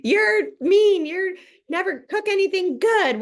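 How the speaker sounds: put-on a child yelling at mom about dinner; whiny kid tantrum. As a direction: go off like an upset kid. Kid going off at parent.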